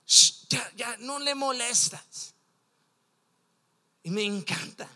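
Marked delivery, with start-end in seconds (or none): 0.1-2.4 long, loud shush, then a mild scolding. Retold or imitated. Preacher acts bystanders hushing the blind men, shushing them. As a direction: hush someone who is bothering the teacher. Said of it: "unos llegaron con, con ellos diciendo".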